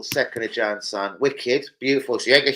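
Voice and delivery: ordinary talk, nothing marked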